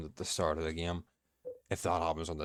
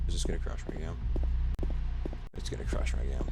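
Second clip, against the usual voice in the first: whispering; 3 semitones lower than usual. Real, not acted. Worried game might crash.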